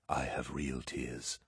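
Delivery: low, slow, hushed grieving voice; Sanguinius, grieving. A narrator's reading. Sanguinius tells the attendant, hushed and slow. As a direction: let the real tears sit in the words, slowly. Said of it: "he said".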